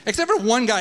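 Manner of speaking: usual speaking voice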